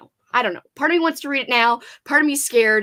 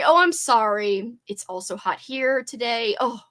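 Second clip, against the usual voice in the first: normal talking voice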